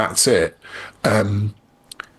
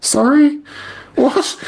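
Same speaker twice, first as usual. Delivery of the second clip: much higher, louder imitation of a baffled woman; bewildered Irishwoman; 3 dB louder, much slower than usual. Retelling poor Bridget's reply to the man, high baffled mimicry. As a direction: squeak it out as two startled questions. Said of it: "poor Bridget from Tipperary is like".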